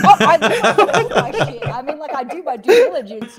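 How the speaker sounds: giggly embarrassed admission; laughing. Real, not acted. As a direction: defend yourself while cracking up. Laughing defence of herself.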